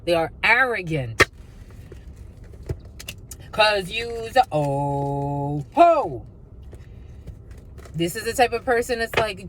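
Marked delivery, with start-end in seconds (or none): 3.5-6.3 stretched, sing-song slow taunt of 'oh ho'. Pretend. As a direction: drag out every word like a teasing chant. Drawn-out taunt.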